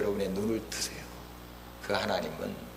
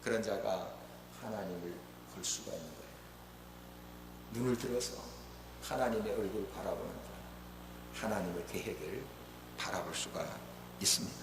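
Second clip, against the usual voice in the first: hushed preaching; 13 dB quieter than usual. Real, not acted. Preacher on gazing at God's face, hushed preaching. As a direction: let it sink almost to a hush. Preacher drops after passionate climax.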